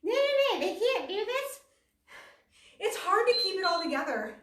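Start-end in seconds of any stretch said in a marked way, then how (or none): 0.0-1.6 high, loud scoffing disbelief at the instructors; mock the gasping teacher with a sneer; judgmental mockery. Judging a video instructor, high loud scoffing. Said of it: "I'm like".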